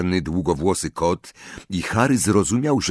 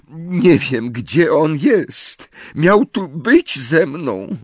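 high, pleading tearful voice; 9 semitones higher, 4 dB louder, much slower than usual. A narrator's reading. Accused wife explains her husband's absence, tearful and high. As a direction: wail it like a frightened wife in tears. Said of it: "Pani Cattermole wybuchnęła płaczem".

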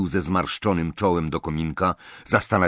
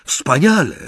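loud, higher relieved exclamation; exclaimed; 10 semitones higher, 8 dB louder than usual. Acted. Harry, relieved, calls out to the goblin. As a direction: call it out in relief. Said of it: "zawołał Harry".

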